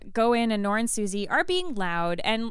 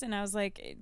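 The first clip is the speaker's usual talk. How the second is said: ordinary talk, nothing marked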